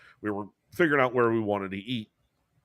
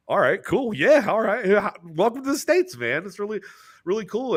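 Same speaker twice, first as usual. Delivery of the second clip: higher, excited and flustered; starstruck excitement; 9 semitones higher than usual. Retold or imitated. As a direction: gush nervously at a hero. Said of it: "And I was like".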